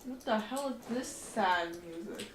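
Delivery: ordinary talk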